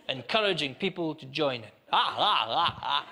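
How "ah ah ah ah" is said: put-on laughing like a child. Fallen drinker urging people to join in, mock childish laughter. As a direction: act out helpless childish laughing on the floor. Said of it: "laugh like a child on the ground".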